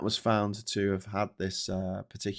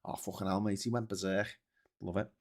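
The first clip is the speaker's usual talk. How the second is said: Liverpool accent; 7 dB quieter than usual. Shown as a demonstration. Telling a mate someone went berserk, Liverpool accent. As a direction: say it as a Scouse mate would, softly. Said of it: "especially in a Liverpool accent".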